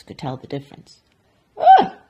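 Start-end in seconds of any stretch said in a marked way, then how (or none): 1.6-2.0 very high, loud yelp; shocked cry. Acted. Duchess biting a bad roll, shocked high cry. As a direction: yelp in horror. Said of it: "cried the Duchess".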